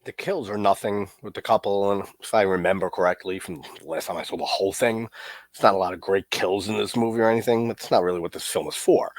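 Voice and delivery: ordinary delivery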